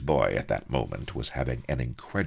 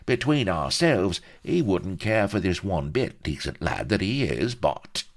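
higher, protesting, easing as it goes; indignant fixer; 8 semitones higher, 5 dB louder than usual. A narrator's reading. Vladimir defending his client to Pepys, indignant. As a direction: start affronted, settle into sly pleading. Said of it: "exclaimed the Fink indignantly".

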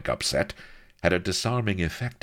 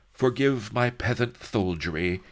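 lisping; 8 semitones higher, 3 dB louder than usual. Acted. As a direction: lisp the apology sweetly. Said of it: "he lisped".

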